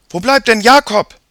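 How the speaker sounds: high, loud calling voice; impatient girl. A narrator's reading. Sister wonders where her brother is, impatient call.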